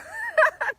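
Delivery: short, high laugh; high burst of laughter. Real, not acted. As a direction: laugh out loud, high and quick. Laughing at her own joke.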